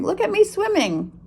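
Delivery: high squeaky little voice; squeaky Roo. Acted. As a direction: squeak it proudly. Roo voiced, proud swimmer.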